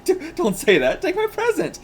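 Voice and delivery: high, pleading plea to take the gift; high pleading boy. Acted. Boy begging girl to accept his present, high pleading voice.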